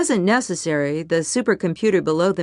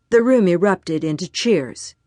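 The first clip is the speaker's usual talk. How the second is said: usual speaking voice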